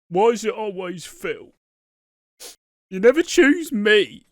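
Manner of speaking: acted jealous complaint. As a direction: imitate a wounded, pleading complaint. Acting out Keanu's jealous complaint.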